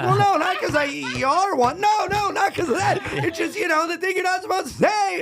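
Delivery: very high, loud, flustered laughing scramble; comic frantically explaining himself to the crowd; panicked backpedal. Retold or imitated. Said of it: "I'm like".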